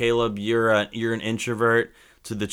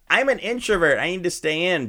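mocking whiny voice; 6 semitones higher than usual. Mocking what people claim.

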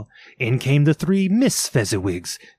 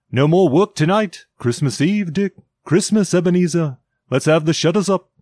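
louder, higher hearty announcement; jovial cry; 5 semitones higher, 4 dB louder than usual. A narrator's reading. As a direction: call it out jovially to the apprentices. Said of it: "cried old Fezziwig".

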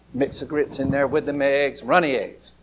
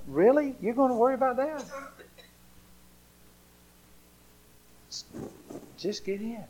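high, fast, teasing disbelief; playful father; 8 semitones higher, 4 dB quieter than usual. Acted. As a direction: laugh it off and tell them to just get in.

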